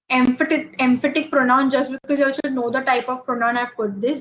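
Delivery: usual speaking voice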